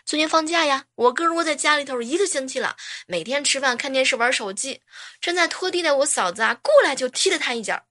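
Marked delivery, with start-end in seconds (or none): none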